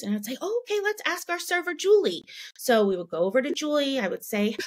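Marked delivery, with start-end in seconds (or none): 0.4-2.3 high, cheerful, quick; bright coaxing mom. Retold or imitated. Mom encouraging daughter to ask the server, high and cheerful. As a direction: lift the voice up to encourage a shy child.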